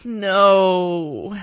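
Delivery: loud, drawn-out groan of dismay. Acted. Mom hearing dinosaurs come with everything, groaning in dismay. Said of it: "my mom groaned".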